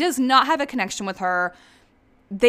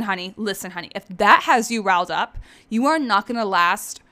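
ordinary talk, nothing marked

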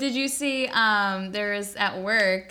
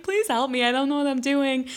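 higher-pitched little plea; helpless retold plea; 4 semitones higher than usual. Diner re-enacting asking the guy for help, high and helpless.

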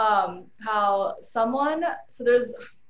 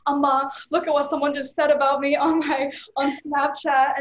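upset complaint; 5 semitones higher, 6 dB louder than usual. Retold or imitated. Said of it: "and I was so mad".

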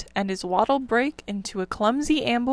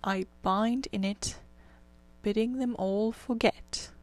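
slow, hushed verse recital; reciting a poem; 5 dB quieter, slower than usual. A narrator's reading. Rat recites the reeds' song to the sleepy mole, slow and hushed. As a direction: intone the reeds' song slowly.

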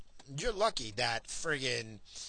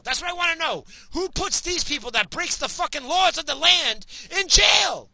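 shouting, much higher and louder; yelling in real outrage at the government; real furious rant; 13 semitones higher, 7 dB louder than usual. Real, not acted. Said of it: "Yeah, I'm worked up".

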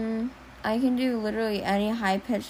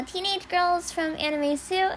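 put-on teenage anime girl; 8 semitones higher than usual. Shown as a demonstration. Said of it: "I can do".

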